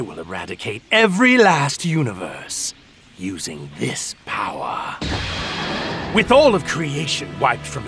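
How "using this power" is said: slow, hushed menace; menacing whisper. Acted. Villain revealing his plan, slow whisper. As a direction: drop to a slow, savouring whisper.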